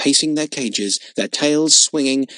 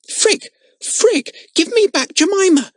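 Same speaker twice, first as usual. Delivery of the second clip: high, louder desperate cry; 5 dB louder than usual. A narrator's reading. Girl crying out to the boy stealing her monkey. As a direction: call after him as he runs off with her monkey. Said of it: "cried the little girl".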